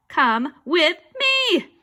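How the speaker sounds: high, loud, very slow order; insistent command. Acted. Ghost orders the refusing boy along, insistent command.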